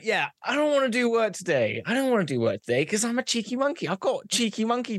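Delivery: high-pitched, insistent whine; cheeky refusal. Acted. Cheeky monkey refusing to work at the office, high insistent whine.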